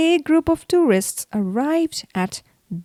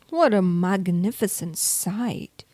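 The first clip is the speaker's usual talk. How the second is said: ordinary delivery, nothing marked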